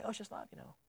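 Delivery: breathy whispered mock of shallow churchgoers; whispered sneer at easy faith; mocking dismissive. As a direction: brush it off in a sly whisper. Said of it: "And say".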